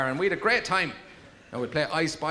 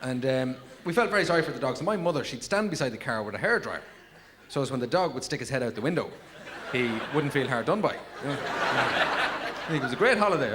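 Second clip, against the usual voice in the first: ordinary talk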